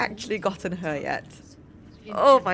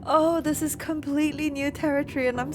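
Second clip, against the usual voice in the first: high, flustered squeal; real nervous freak-out with a laugh; 8 semitones higher, 4 dB louder than usual. Real, not acted. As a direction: fret in a high voice, building louder. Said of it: "I'm so nervous".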